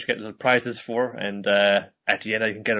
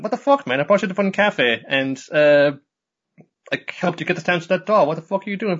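mock angry Nodoka; 7 semitones higher than usual. Nodoka scolding the rabbit, high and angry. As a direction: vent at the rabbit in a squeaky cartoon voice.

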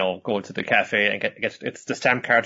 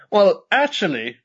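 prim Nodoka; 9 semitones higher, 4 dB louder, slower than usual. Retold or imitated. Voicing Nodoka.

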